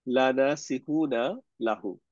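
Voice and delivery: reads the Arabic phrase out slowly, word by word; slow Arabic reading. Shown as a demonstration. Teacher slowly reading the Arabic phrase aloud to students. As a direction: spell out each Arabic word slowly for the class. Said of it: "এখানে যে লাটা দেখতেছে".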